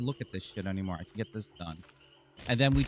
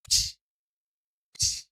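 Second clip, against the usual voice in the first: mock slap noises; 3 dB louder than usual. Imitated slaps from Lucy.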